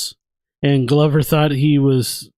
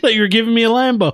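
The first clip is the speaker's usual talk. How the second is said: quick, higher-pitched mock protest; Glover; 8 semitones higher, faster than usual. Friend learns the Lambo isn't his, mock protest. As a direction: rush out a put-on complaint.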